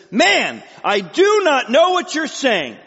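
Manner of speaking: high, loud, slow emphatic denial; emphatic, scared denial. Acted. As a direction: hammer out each word in acted desperation. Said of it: "so emphatic now, so scared to death".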